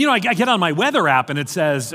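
ordinary talk, nothing marked

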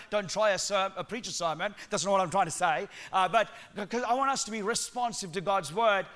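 ordinary talk, nothing marked

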